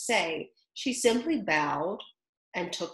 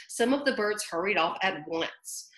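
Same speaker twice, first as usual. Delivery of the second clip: ordinary delivery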